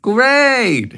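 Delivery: bright, high motherly voice; a cheerful mother nudging her son; mom's voice. A narrator's reading. Mother encouraging son about Italy, high and bright. Mom voiced in read story.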